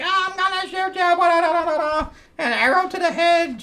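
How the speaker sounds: high falsetto blustering; mock battle cry. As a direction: babble a silly threat way up high. Mocking the attacking hero.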